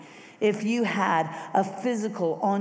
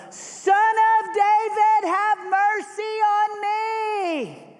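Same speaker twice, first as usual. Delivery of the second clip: high, loud, slow cry for mercy; a blind beggar crying out to Jesus, desperate bellow; 8 dB louder, much slower than usual. Acted. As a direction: bellow it across the crowd. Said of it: "he begins to bellow".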